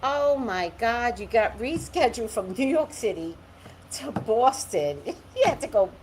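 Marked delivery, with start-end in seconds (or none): none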